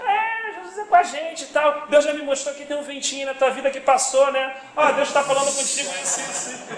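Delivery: high voice, eagerly prophesying; imitating a faithful sick woman. Sick sister consoling her would-be consoler, imitated in a high voice. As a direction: turn the comfort back on the caller, pitched high. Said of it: "eu ligando pra consolar ela".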